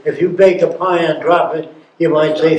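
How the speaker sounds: ordinary talk, nothing marked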